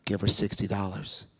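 a deep, calm divine order; God's command. Retold or imitated. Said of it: "the Lord be like".

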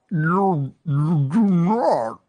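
slow, loud drawn-out words; slow motion talk. Pretend. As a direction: speak like slowed-down audio. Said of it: "when we talk slower".

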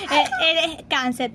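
slow, laughing through the words. Real, not acted. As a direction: giggle out the insult. Laughing while insulting friend.

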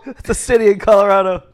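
high, loud, laughing correction; exasperated amusement; amused correction. Real, not acted. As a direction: crack up while setting them straight. Cracking up at wrong answer.

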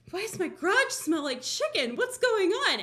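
high-pitched mock confusion; comic puzzled questioning; acted bewilderment. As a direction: ask it high, as if totally baffled. Acting out puzzlement at the smell.

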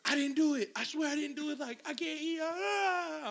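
high, rising plea breaking into a wail; panicked pleading. Retold or imitated. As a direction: act out a kid begging off a whooping. Pleading panic before the beating.

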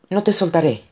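urgently promise, pitched high; grito. A narrator's reading. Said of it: "gritó a su vez Connor". (grito agudo, algo contenido)

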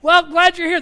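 loud, high calling out across a bar; rowdy bar crowd shouting a greeting; crowd yelling. Retold or imitated. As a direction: hail a regular as he walks in. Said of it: "They started yelling out his name".

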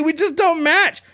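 very high squeaky whine on "We just don't match."; "We just don't match." in a shrill mocking whimper; whiny mock [name]. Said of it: "He's like".

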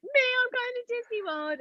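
very high, squealed announcement; overjoyed reveal. Retold or imitated. Daughter telling her mum she's going to Disney, overjoyed. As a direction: re-enact squealing the big news, near to tears. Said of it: "And she cried".

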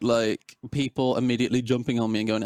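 normal talking voice

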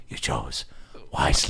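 put-on low, hushed old knight; imitating the old knight, low and soft; old man. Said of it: "the old man goes".

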